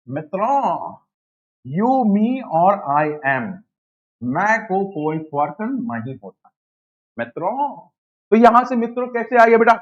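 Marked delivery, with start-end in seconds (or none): 0.1-7.8 lightly voiced Modi-style mock speech; slow, drawn-out mock address to the crowd; teacher mimics PM Modi's mock speech to students; imitating Modi. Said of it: "PM Modi ने Hong Kong से बोला".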